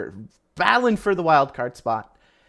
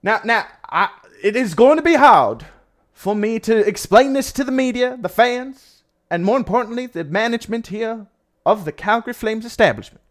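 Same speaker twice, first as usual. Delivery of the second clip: put-on Texas oil baron accent; 4 semitones higher, 5 dB louder than usual. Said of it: "sound like a Texas oil baron".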